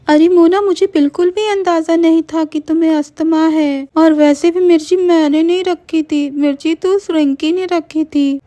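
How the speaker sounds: high, pleading voice; desperate excuse. Acted. Meenal blaming Rinki to save herself, high and desperate. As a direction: shift the blame fast. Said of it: "Meenal कहती है".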